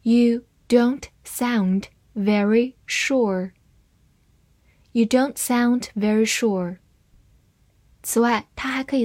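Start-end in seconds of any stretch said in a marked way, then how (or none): 0.0-3.5 word by word, with clear gaps; slow, deliberate model reading. Shown as a demonstration. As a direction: read it slowly for learners, separating each word. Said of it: "慢慢来读".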